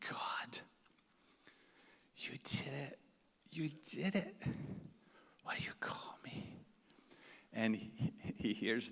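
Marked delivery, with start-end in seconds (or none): 0.1-6.6 hushed tearful whisper to God; whispered prayer. Retold or imitated.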